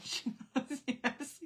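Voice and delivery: high voice squeezed by real laughter; laughing. Real, not acted. Mother retelling her daughter's answers about the sauna, laughing. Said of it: "I don't know why I'm laughing".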